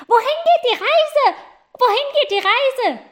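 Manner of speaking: put-on very high, loud children's call. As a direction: shout up from the schoolyard. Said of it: "riefen die Kinder".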